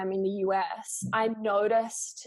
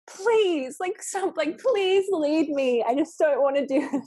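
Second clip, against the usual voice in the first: much higher, louder, slow begging plea; pleading; 8 semitones higher, 6 dB louder, slower than usual. Retold or imitated. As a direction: beg to be led, drawn out and high. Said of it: "feeling like saying".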